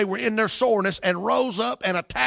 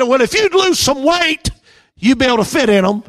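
higher and louder, slowed sarcastic comeback; retorting stewardess; 7 semitones higher, 9 dB louder than usual. Retold or imitated. Stewardess firing back at the complaining passenger, loud sarcasm. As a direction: fire the insult straight back at him.

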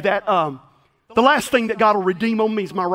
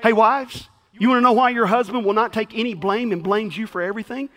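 ordinary talk, nothing marked

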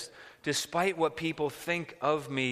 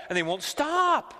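ordinary talk, nothing marked

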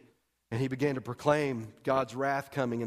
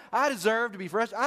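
higher and louder, pushing the grievance; louder complaint; 7 semitones higher, 5 dB louder than usual. Acted. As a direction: insist it harder. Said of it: "I can hear him saying this".

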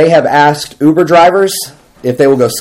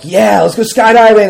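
higher-pitched mock group cheer; hyped-up bravado; 8 semitones higher than usual. Friends brave after drinks agree to skydive, hyped-up bravado. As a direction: egg each other on, excitedly. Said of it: "they got real brave".

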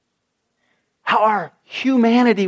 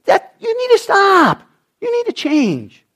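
mock scold; 13 semitones higher than usual. Voicing someone scolding the hurt.

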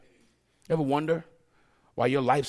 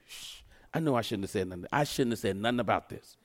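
hushed aside; 8 semitones lower, 8 dB quieter than usual. Acted. Preacher confessing an aside to the church, hushed. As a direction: drop to a low, soft confiding voice.

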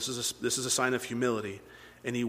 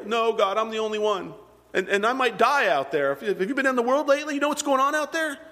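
high, agitated excuse-making as Elijah; panicky protest; 9 semitones higher, 3 dB louder than usual. Acted. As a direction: plead your case, pitch climbing. Voices Elijah protesting to God.

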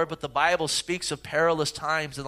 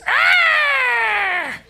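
high, loud, strained screech; a harsh night-bird shriek, way up high; owl screech; 8 dB louder than usual. Retold or imitated. Pastor imitating the noisy owl for his congregation, loud high screech. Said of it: "It is this".

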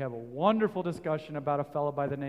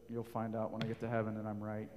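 mock dad preacher; 4 semitones lower, 6 dB quieter, faster than usual. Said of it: "as my dad says often from the pulpit".

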